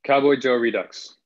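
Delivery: ordinary delivery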